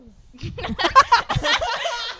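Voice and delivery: high, loud burst of laughter; loud cackling laugh. Real, not acted. Co-host cracking up at the Luchito joke, loud high laugh. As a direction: burst out laughing and keep going.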